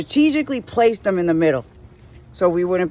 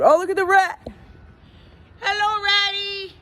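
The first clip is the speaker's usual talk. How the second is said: high cooing voice to an animal; cooing at a rat; 10 semitones higher than usual. Real, not acted. Driver spotting a rat on the road, high coo.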